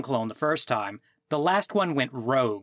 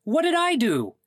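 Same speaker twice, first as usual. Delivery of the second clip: indignant; 11 semitones higher than usual. Acted. Caller indignant at blame.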